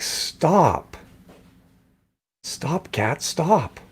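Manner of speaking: weary stop, stop, stop; exasperated scolding. Retold or imitated. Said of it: "It's like".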